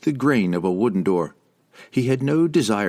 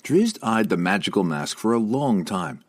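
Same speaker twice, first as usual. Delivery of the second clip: ordinary delivery, nothing marked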